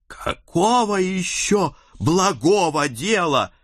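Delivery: can't hold back a hot retort; fiery daughter. A narrator's reading. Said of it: "не удержалась моя пылкая дочь".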